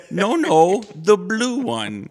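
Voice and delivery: high, very slow and precise; a slow, high put-on butler voice; mock bit voice. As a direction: drag each word out politely. Acting out a comedy bit.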